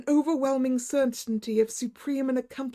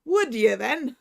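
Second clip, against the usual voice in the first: loud Irish taunt; Irish doctor daring him on; Irish accent; 6 dB louder, slower than usual. Acted. Irish doctor taunting the frightened man. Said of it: "in his own unmistakable accents".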